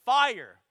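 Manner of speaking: "Fire" shouted alone, high and loud, falling; emphatic stress. Shown as a demonstration. Preacher showing the Greek word order, punched emphasis. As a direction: hit "Fire" hard as the first word. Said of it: "It's in the emphatic position".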